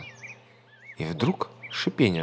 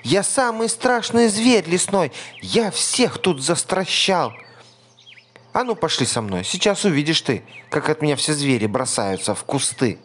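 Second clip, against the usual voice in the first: high, loud bragging squeak; boastful mouse; 7 semitones higher, 4 dB louder than usual. A narrator's reading. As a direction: brag like the fiercest beast in the woods.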